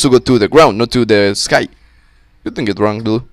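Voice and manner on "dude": normal talking voice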